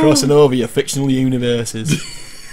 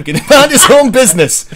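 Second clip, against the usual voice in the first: higher, louder mock northern scolding; laughing northern English rant; broad northern accent through giggles; 6 semitones higher, 9 dB louder than usual. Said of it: "Northern Spider-Man".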